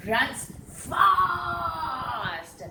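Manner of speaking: lively mock shout; excited. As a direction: shout it like the rabbit darts off. Acts out a rabbit running.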